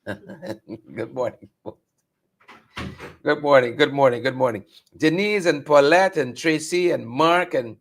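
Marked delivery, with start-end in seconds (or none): 0.1-1.5 laughing greeting. Real, not acted.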